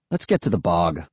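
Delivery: low-pitched, understated impatience; impatient. A narrator's reading. Boy wanting to move on, mildly impatient. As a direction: press on with mild impatience. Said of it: "Will said impatiently".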